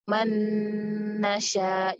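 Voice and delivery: "man nasya" run together through a long, hummed n; merged nun with ghunnah. Shown as a demonstration. Teacher showing students how nun merges into the next letter, merged ghunnah. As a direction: draw out the n in "man nasya" as a humming nasal, joined on. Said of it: "kita leburkan".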